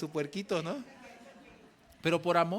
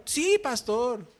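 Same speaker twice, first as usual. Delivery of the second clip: slow, higher eager yes; obedient yes; 8 semitones higher, 7 dB louder, much slower than usual. Retold or imitated. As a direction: answer like a grateful disciple. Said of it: "Gabriel answers".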